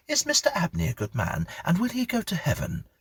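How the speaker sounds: put-on a boy asking a big question; curious young boy. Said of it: "he suddenly asked".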